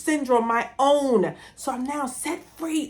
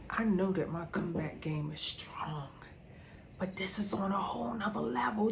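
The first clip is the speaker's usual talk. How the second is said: hushed, softer, voiceless aside; whisper; 4 semitones lower, 12 dB quieter than usual. Real, not acted. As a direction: let it slip out as a secret. Private confession to herself.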